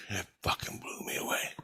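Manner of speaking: soft, breathless hush; hushed, unvoiced disbelief; awed whisper. Real, not acted. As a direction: whisper it, still stunned. Repeats line, dropping to whisper.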